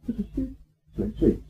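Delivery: sleepy hush. Acted. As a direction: drop to a near whisper. Preacher hushes on the word sleep.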